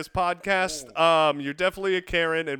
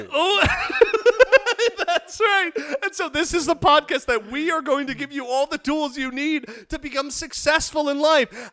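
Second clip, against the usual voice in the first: laughing; 6 semitones higher than usual. Real, not acted. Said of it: "Ooh laugh".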